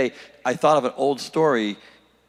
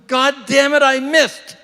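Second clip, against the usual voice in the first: very high, loud wail of exasperation; high-pitched golfer's outburst; frustrated yell; 7 dB louder, slower than usual. Acted. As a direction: cry it out high, at the end of your patience. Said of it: "and he goes".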